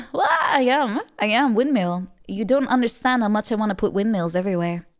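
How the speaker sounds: ordinary delivery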